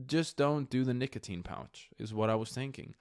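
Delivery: usual speaking voice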